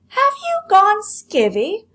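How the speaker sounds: loud, high, slow shout. Acted. Lolly shouting at her mother, loud, high and slow. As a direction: yell it like she thinks her mother is crazy. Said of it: "shouted Lolly".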